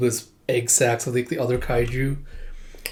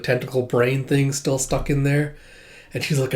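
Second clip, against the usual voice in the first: usual speaking voice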